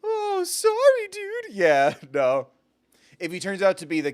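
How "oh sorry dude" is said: tiny sad falsetto apology; Hootie voice. Acted. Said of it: "give him the Hootie voice".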